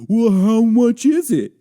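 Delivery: high, drawn-out mock-naive voice; foolish mock question. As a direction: stretch it out loud and high, mocking yourself. Quotes own foolish question to hooker.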